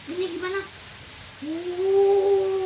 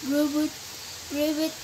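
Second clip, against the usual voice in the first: ordinary talk, nothing marked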